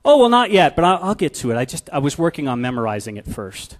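put-on a caught-out child dodging the chore; daughter's excuse. Daughter excuses unclean room.

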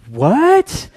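a high, baffled "What?" from a deaf dad; puzzled dad's high "what". Retold or imitated. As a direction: play the dad who still can't hear.

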